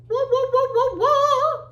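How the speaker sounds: high, loud wobbly "wah" sounds; bouncy wahs ending on a held one; playful wah noises. Pretend. Teacher playing with alpaca stretchies, high wah noises.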